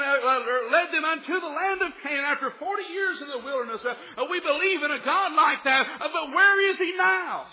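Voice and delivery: building from plain to high, loud anguished questioning; rising preacher's plea as Gideon; Gideon's complaint. Retold or imitated. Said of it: "And Gideon's saying".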